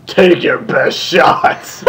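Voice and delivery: slow, sultry mare purr; seductive mare's voice. A narrator's reading. Villain taunting the six friends in a slow seductive mare's voice. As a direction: drawl it out slow to tempt them. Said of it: "he spoke in a seductive mare's voice".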